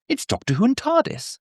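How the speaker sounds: very high, put-on little voice; mock squeaky quote. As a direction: send the line up in a silly high pitch. Mock quote of the older style.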